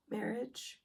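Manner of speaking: ordinary delivery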